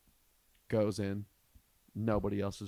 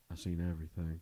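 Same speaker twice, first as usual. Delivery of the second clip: war-weary vet; 5 semitones lower, 4 dB quieter, faster than usual. Retold or imitated. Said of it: "said it like he was a fucking Vietnam vet".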